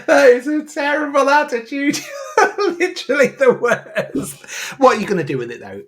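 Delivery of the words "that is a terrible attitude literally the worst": higher, laughing through the words; mock outrage cracking into real laughter. Real, not acted. Laughing at the friend's cheek.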